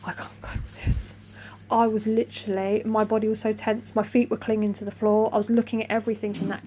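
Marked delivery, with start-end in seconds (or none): 0.1-1.1 whispered panic; panicked whisper. Retold or imitated. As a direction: breathe it out in terror. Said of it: "And I'm there like".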